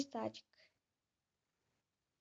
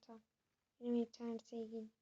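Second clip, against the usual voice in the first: ordinary talk, nothing marked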